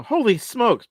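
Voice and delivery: a big excited yell; yelled exclamation. Shown as a demonstration. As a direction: shout it out high and loud. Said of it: "something you're just yelling out".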